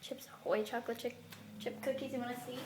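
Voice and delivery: usual speaking voice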